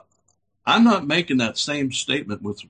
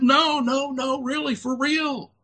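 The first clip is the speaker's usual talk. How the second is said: high, insistent mock pleading; a hammed-up buddy insisting it's true; 14 semitones higher than usual. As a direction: beg her to believe you. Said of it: "He said".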